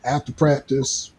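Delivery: ordinary talk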